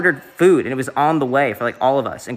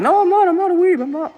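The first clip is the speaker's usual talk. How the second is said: very high-pitched, badly imitated accent; Connor's accent; 4 dB louder than usual. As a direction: squeal a denial in a bad mock accent. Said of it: "He was, like, in his, like, accent".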